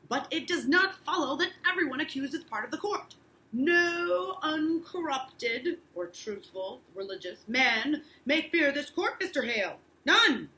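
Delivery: stern judge. Acted. Teacher reads Danforth's rebuke.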